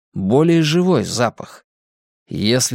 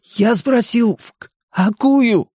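high raised voice catching on a consonant; stuttering repeat; 11 semitones higher, slower than usual. A narrator's reading. Stuttering boy repeats his question to Eddie, raised voice. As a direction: stammer it out high and insistent.

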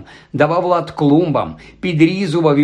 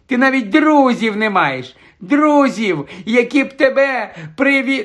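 high, stammering panicky protest; frightened; 6 semitones higher than usual. A narrator's reading. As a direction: stumble over words in rising alarm. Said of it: "на його масистому обличчі проступив страх". (перелякано, затинаючись)

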